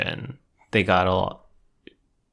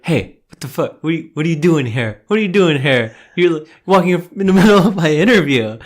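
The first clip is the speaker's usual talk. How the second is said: raised, quick imitation of annoyance; mock indignant outburst; 6 semitones higher, 6 dB louder than usual. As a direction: build to a loud, high mock protest. Acts out Jordan's annoyed outburst.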